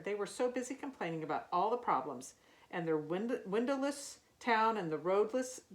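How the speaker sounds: usual speaking voice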